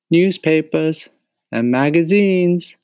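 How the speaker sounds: stretched-out vendor's call. Acted. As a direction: call the papers out to passers-by. Mario calling out wares.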